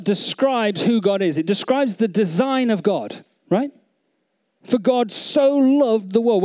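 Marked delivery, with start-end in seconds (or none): 4.6-6.4 scripture reading. Shown as a demonstration. Quoting verse again, set apart.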